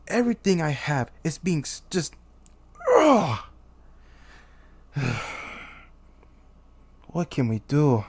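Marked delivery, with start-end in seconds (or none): 3.0-3.4 loud, high groan of exasperation; high, falling cry of frustration; frustrated groan. Acted. King breaks off in frustration. 4.9-5.6 soft, deflated sigh; long defeated exhale; weary sigh. Acted. Sighs after the outburst.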